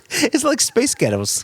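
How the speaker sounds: high and loud through laughter; giggling out the punchline; laughing. Real, not acted. Joking with the co-host about misheard words, laughing. Joke on accents.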